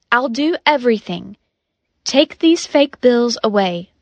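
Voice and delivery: ordinary delivery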